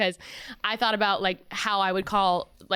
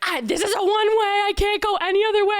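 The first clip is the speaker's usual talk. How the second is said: high, loud, fast panic; frantic raised voice; panicked yelling; 12 semitones higher, 5 dB louder, faster than usual. Retold or imitated. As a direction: yell it like you're trapped on a one-way street. Retold panicked call to boyfriend.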